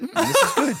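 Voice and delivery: put-on high, pleased little-kid voice. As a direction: pitch up, content child. Son enjoying parfait, voiced.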